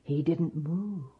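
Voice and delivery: soft, whispered storytelling; hushed narration. A narrator's reading. Storyteller hushes over the dead giant, softly whispered. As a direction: whisper it over the still body.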